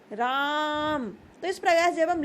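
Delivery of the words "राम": राम with the आ held long, like calling someone far away; long drawn-out vowel. Shown as a demonstration. Teacher demonstrating pluta by drawing out राम. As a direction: stretch the आ in राम into a long call. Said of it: "उसे बहुत लंबा समय लेकर हम बोलते हैं".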